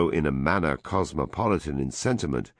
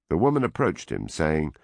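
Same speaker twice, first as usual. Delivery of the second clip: plain reading voice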